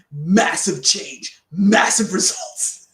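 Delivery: loud, slow booming hype announcer; big announcer voice. Retold or imitated. Coach acting the voice in their head, hammed-up announcer. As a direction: boom it out slowly like a truck-show announcer. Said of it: "That's the voice in my head".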